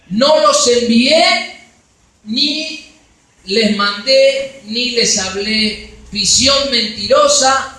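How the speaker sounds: loud, slow fiery scripture reading; preaching at full force. Acted. Preacher reading Jeremiah to the church, loud and slow. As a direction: thunder out God's words to the church.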